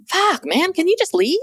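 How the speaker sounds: high, exasperated plea to leave; wife pushed past patience, high and exasperated; fed-up exasperation. Retold or imitated. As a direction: sound completely done with him.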